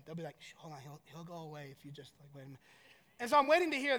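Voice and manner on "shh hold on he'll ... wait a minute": very soft and low, secretive; hushed kids' plotting. Retold or imitated. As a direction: imitate kids conspiring out of earshot. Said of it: "like you can't hear 'em".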